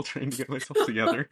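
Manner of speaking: ordinary delivery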